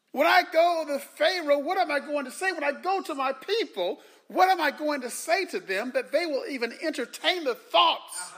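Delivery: high, pleading, worried voice; anxious Moses voice. Retold or imitated. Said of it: "So, so Moses is saying".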